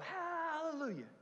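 high, soft sarcastic praise; sarcastic church voice; mock hallelujah.

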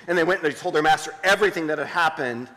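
ordinary delivery

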